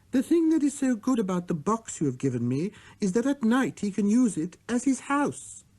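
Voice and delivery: put-on high childlike voice, pitched well up; little prince voice. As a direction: speak as a small boy musing.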